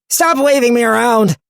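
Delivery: high, loud shrieking knife; talking knife yelling. Acted. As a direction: yell it like a dizzy knife. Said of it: "said the knife".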